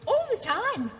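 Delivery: high, loud all the time; Randy's catchphrase. Retold or imitated. Host retelling the rant bit, high and loud. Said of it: "there's a bit where he goes".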